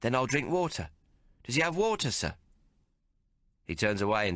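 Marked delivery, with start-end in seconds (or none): 0.0-2.3 innocent asking. A narrator's reading. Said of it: "all innocent".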